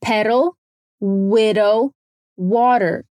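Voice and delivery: "Pedal, widow, water" one by one, soft D in each; careful American "Pedal, widow, water", pauses between; soft D drill. Shown as a demonstration. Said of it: "o mesmo som de D mais suave".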